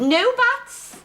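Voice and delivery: high, loud reprimand; stern cut-off. Acted. Headmistress silences a pupil's objection, sternly. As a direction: shut down the protest sharply.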